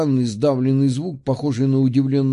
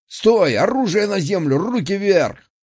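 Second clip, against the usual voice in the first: shouting orders over battle; 6 semitones higher than usual. A narrator's reading. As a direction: bark the halt like a frightened sentry. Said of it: "истошно заорал сержант". (истошный крик)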